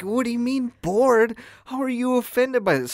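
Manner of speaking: high-pitched, exasperated comeback; retold indignant protest. Retells his protest to offended girl.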